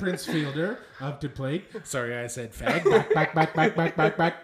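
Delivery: sportscaster's call building to a loud chant; Chris Berman. Acted. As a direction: punch out each repeat rhythmically. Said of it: "It's Chris Berman".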